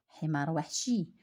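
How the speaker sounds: usual speaking voice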